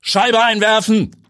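high, loud, slow barked command; commanding order. A narrator's reading. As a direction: snap the order at the troops. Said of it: "befiehlt der Truppenchef umgehend".

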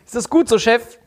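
eager to please the boss; eager employee. Retold or imitated. Employee voiced in acted scene.

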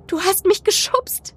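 high, slow tearful accusation; sobbed accusation. A narrator's reading. Crying narrator accusing friend of pushing, sobbing. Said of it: "schluchzte ich".

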